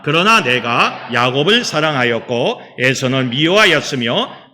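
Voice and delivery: loud, measured declamation in even phrases; scripture recitation. Acted. Pastor reading God's answer with the congregation, solemn declamation. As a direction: lead a joint reading of holy text.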